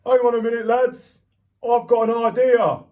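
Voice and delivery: fireman's voice. Acted. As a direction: sing out to the lads like a chipper fireman.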